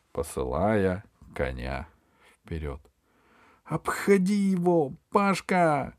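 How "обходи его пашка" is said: very high, urgent call; crying out. A narrator's reading. Alisa coaches Pashka from the stands, crying out. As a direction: call out to him, pitched way up. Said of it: "крикнула Алиса изо всех сил".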